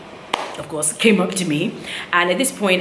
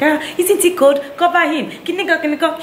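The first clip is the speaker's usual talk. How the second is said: put-on high-pitched fussing old lady; mock fussy older lady; 11 semitones higher than usual. Older lady on another flight fussing at the mother, mock high nagging. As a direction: imitate the meddling auntie, pitched well up. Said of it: "an older lady was like".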